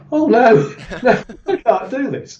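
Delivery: high-pitched, laughing cry of dread; hammed-up reluctance, voice jumping up; mock panic. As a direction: balk at the jump in play panic. Acting panic at the edge.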